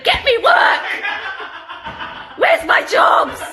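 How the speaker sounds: high, loud mock yell at an agent; mock demanding shout. Imagining a friend as their agent, mock demanding shout. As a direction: yell it as a hammed-up angry client.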